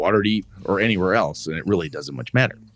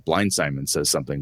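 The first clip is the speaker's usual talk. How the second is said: normal talking voice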